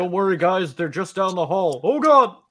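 panicked stormtrooper. Acted. Voicing stormtroopers.

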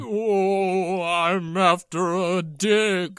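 slow, trembling mock fear; a hint of a frightened little man; scared voice. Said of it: "you sound scared".

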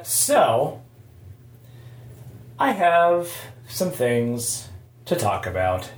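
usual speaking voice

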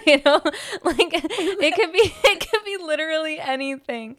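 laughing through the words, pitch jumping up. Real, not acted. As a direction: giggle out the line as you talk. Laughing while talking.

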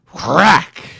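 very loud high crack. A narrator's reading. Narrator voicing lightning. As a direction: blast it like thunder. Sound effect in story.

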